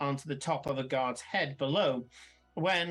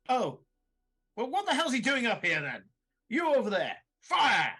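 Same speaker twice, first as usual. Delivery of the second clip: loud, high exasperation building to a shouted order; raised commanding officer's voice; irritated duke; 8 semitones higher, 5 dB louder than usual. Acted. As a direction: snap at the men, then bellow the command. Duke's acted irritated order.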